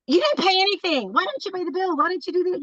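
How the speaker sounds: mock argument. Imitating a bill argument with her partner, mock nagging. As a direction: whine the blame like a bickering spouse. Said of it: "turns into a four-day argument".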